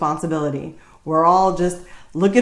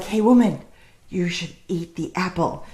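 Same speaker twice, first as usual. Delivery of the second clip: devil tempting; 3 semitones lower than usual. Acted. Devil coaxing the woman to eat the apple, lightly voiced tempter. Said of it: "And then the devil comes around. He's like".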